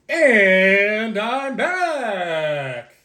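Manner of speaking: drawn-out, high singsong; singsong announcement. Real, not acted. Vlogger returning to viewers after a cut, singsong. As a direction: stretch it out high and playfully.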